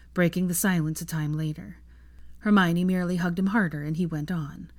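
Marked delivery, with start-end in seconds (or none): none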